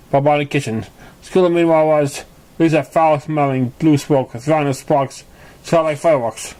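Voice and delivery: plain reading voice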